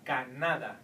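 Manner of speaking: "Canada" as ca-NA-da; second-last syllable stress. Shown as a demonstration. Teacher showing the word without its tilde, middle-syllable stress. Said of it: "pronounce it like in the vowel rule".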